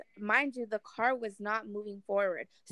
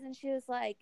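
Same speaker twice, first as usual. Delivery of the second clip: usual speaking voice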